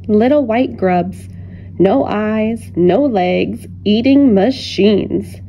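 usual speaking voice

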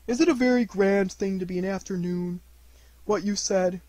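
wondering little bear, gently puzzled; curious Pooh. Acted. Pooh asks Christopher Robin about knights, curious character voice.

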